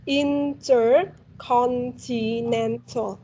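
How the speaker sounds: "Intercontinental" very slowly, syllable by syllable; broken into syllables. Shown as a demonstration. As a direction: cut "Intercontinental" down into slow, separate parts. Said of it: "you just need to cut it down".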